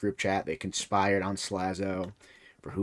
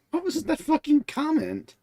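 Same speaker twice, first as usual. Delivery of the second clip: incredulous outburst; 4 dB louder than usual. Real, not acted. Incredulous reaction to the comment.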